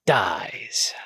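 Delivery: whispered, trailing off; whispered death. Acted. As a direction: breathe the word out like a last gasp. Punchline of heartbreak.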